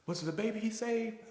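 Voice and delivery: sudden high, breathless question; gasping disbelief. Retold or imitated. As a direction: ask it like the breath was knocked out of you.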